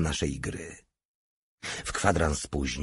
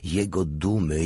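usual speaking voice

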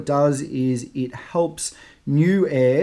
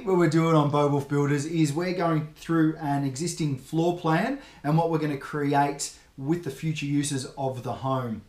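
normal talking voice

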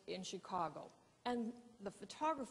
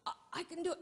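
panicky; 9 semitones higher, much faster than usual. Retold or imitated. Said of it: "I'm choking, panicking".

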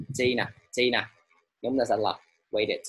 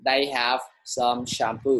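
slow, clear model sentence; 2 semitones higher, much slower than usual. Shown as a demonstration. Teacher reading a model sentence to the class, slow. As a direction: spell it out slowly for the class. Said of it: "thầy sẽ cho các bạn một câu ví dụ".

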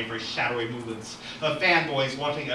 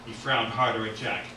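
ordinary delivery

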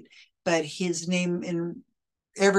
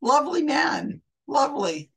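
dry mock praise, higher on the first line; sarcastic; 7 semitones higher than usual. Real, not acted. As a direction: call him lovely and mean the opposite. Sarcastic verdict on the psychic.

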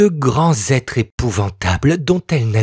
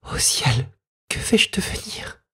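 frightened whisper; 6 dB quieter than usual. A narrator's reading. Little girl whispering to herself, hidden in fear. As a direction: hush it as a private terrified thought. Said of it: "pensa-t-elle".

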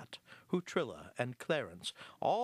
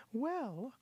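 small high mouse voice, hesitant; timid high-voiced opener; shy mouse; 12 semitones higher than usual. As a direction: begin shyly, pitched high. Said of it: "Hortense began shyly".

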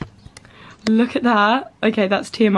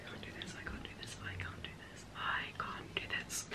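frightened whisper; 25 dB quieter than usual. Real, not acted. Dreading pulling the strip again.

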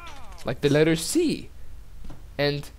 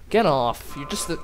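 normal talking voice

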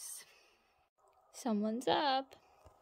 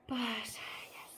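whispering; 4 dB quieter than usual. Real, not acted. Quiet vlog while baby sleeps.

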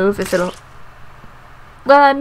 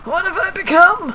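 high, dramatic wail; hammed-up high lament; mock horror; 8 semitones higher than usual.

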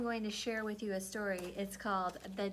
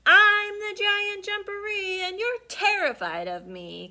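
booming, much louder giant threat; loud monster voice; 11 semitones higher, 11 dB louder than usual. Acted. Hidden giant roaring at Mama Frog. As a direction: roar it out of the burrow. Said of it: "came a loud voice".

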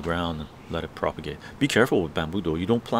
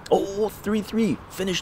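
1 surprised; 11 semitones higher, slower than usual. Real, not acted. Reacting to hockey score on chat.

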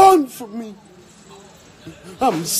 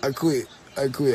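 ordinary talk, nothing marked

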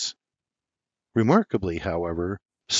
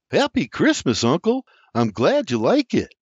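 higher, louder greeting; William's greeting; 7 semitones higher, 4 dB louder than usual. A narrator's reading. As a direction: wish him a happy Christmas. William greets his uncle.

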